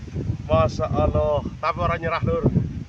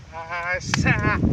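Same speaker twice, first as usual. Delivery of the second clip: ordinary delivery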